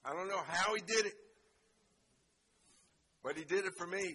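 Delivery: the preacher testifies, building to a roaring shout; full-force preaching. Acted. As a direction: climb to a fiery preaching climax.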